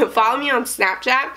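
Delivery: ordinary talk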